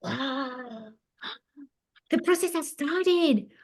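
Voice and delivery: put-on high, loud amazed little boy; excited child. Boy watching his caterpillar start its cocoon, high and amazed. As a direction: gasp it out like a kid seeing magic. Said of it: "says Yossi".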